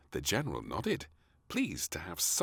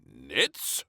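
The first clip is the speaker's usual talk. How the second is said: gasped; 3 semitones lower, 4 dB louder than usual. A narrator's reading. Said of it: "gasped the Prime Minister".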